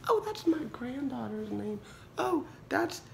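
put-on high, chirpy old lady voice; a patient recalling her granddaughter, mock old lady. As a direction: imitate a sweet grandma remembering a name. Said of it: "half the time they're like".

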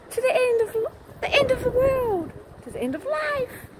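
high-pitched, theatrical sign-off; mock-grand declaration.

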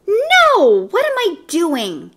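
high, loud "no" easing into self-questioning; alarmed thought. A narrator's reading. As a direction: catch yourself in sudden alarm. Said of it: "he thought".

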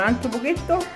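usual speaking voice

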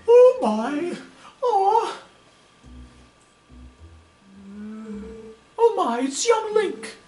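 high old lady voice full of hammed-up worry. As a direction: play the fretting old lady who lost her cat.

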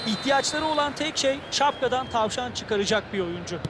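ordinary talk

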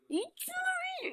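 slow eerie high "İç şunu"; creepy voice. Acted. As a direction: drawl "İç şunu" like a spooky stranger. Sinister voice forcing a drink.

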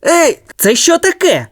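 high indignant shout; angry passer-by. A narrator's reading. The passer-by is hit by a stone, indignant. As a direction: snap up at the balcony. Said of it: "розсердився перехожий".

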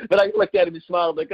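usual speaking voice

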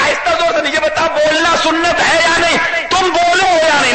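high, insistent demand; heated cross-examining. Acted. Worshipper presses imam for an answer, heated.

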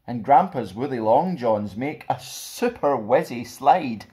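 put-on lively, bouncy picture-book narration for kids; animated rhyming read-aloud; storybook reading. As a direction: read it like a fun bedtime story to children. Reads picture book to children.

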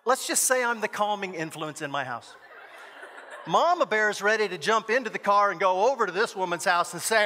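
ordinary talk, nothing marked

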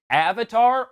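Avatar first with short a as in cat, then ah; contrasted pronunciations. Shown as a demonstration. Critic asking which pronunciation is right, contrasted vowels. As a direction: set the two vowels of Avatar against each other, loudly. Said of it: "how Avatar should be pronounced".

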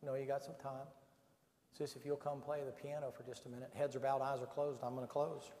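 normal talking voice

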